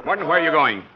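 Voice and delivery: panicked push to get help down the gully; urgent shouting. Acted. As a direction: boss yelling for help after a fall. After a man falls off the cliff.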